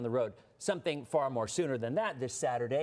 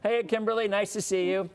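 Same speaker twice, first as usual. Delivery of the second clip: excited greeting; 7 semitones higher, 7 dB louder than usual. Real, not acted. Host greets guest's daughter on camera.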